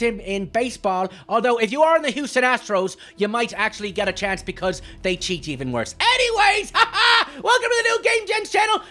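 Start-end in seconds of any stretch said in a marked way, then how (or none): 6.0-8.8 excited intro shout. Real, not acted. Host welcoming viewers, high excited shout. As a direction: announce the channel with a big laugh.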